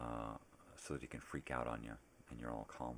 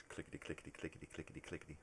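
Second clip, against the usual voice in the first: imitated rapid typing; 5 dB quieter, faster than usual. Imitates fast thumb typing.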